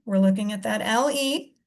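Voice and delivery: ordinary delivery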